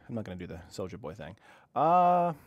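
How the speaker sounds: usual speaking voice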